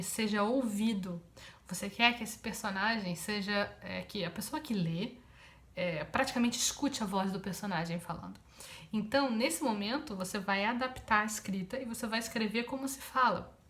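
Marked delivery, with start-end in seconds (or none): none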